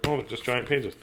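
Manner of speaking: plain reading voice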